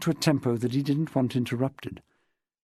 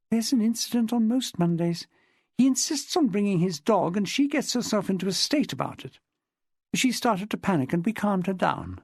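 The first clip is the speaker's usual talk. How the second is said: higher-pitched woman's dialogue voice; lightly voiced chatty woman witness; 8 semitones higher than usual. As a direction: lightly play a woman recounting her club life. Narrator voices Jessica in dialogue.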